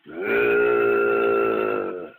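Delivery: long, very low, drawn-out rumble; low, deep growl. Retold or imitated. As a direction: imitate a deep distant creature groan. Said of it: "It was low, but it was, like, real deep".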